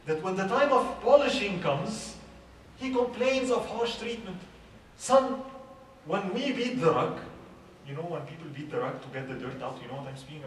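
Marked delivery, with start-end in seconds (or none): 0.1-7.2 slow, louder and higher, like a father lecturing; poet's father addressing son. Retold or imitated. A father slowly urging his son, raised and lifted. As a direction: stretch it out and lift the voice to teach the son. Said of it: "there is this poem that says".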